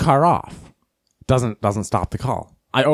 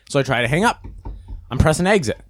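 usual speaking voice

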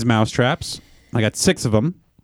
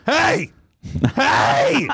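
yelling; 12 semitones higher than usual. Retold or imitated. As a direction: yell "hey" to scare off a critter. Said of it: "I start yelling at it".

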